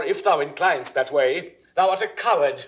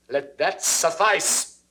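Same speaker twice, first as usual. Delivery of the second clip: ordinary delivery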